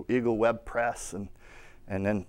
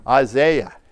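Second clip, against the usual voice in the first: British-style "Isaiah", second syllable as ZAY; British accent; 7 dB louder than usual. Shown as a demonstration. Preacher showing the congregation a British accent on "Isaiah". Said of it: "if I say it with that British accent".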